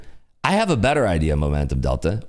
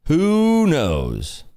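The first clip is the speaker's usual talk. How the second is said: "Who knows?" lifted higher and stretched out on "Who"; drawn-out playful; 5 semitones higher than usual. Shown as a demonstration. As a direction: toss "Who knows?" up high and draw out the "Who".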